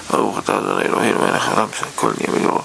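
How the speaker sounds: low, softly murmured blessing, trailing into voicelessness. Real, not acted. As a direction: say a quick private blessing under your breath. Says a blessing before drinking.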